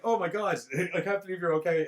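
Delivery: high, loud mock relief; imitated excited outcry; relieved gushing. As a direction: gush like overjoyed friends. Retells friends' relieved cries.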